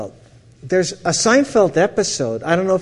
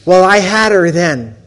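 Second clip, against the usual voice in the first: louder, proud emphasis; triumphant; 3 semitones higher, 8 dB louder than usual. Real, not acted. Speaker telling the audience he'd won her over, loud and proud. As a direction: announce your win.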